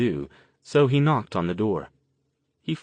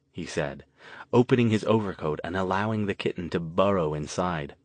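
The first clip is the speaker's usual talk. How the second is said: plain reading voice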